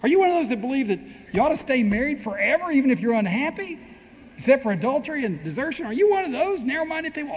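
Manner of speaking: high-pitched, incredulous grilling; mock scandalised accusation. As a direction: pile on the disbelief, voice climbing high. Acted accusation of narrow-minded Christians.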